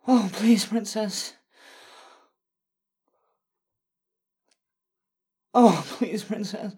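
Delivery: high, loud, begging moans; loud desperate pleading. Acted. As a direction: cry out, begging her.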